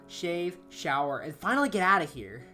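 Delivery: ordinary delivery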